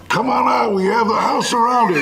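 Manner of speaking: high, loud standoff order; police yell. Retold or imitated. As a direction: holler it like cops outside the house. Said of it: "when they yelled".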